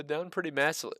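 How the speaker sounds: ordinary delivery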